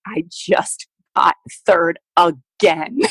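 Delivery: very slow, defeated repeat; flat-out crushed, weary; devastated deadpan. Retold or imitated. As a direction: drag it out, emptied of hope. Devastated athlete answering testing staff.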